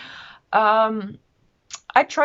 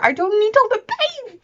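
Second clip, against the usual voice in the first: emphatic plea; 14 semitones higher, 6 dB louder than usual. Real, not acted. Pleading about fictional character suffering.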